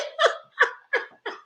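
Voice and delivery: long wheezy high laughing fit; real laughter. Real, not acted. Recapper tickled by the ignored stripper, helpless laughter. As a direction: lose it laughing, barely any voice left.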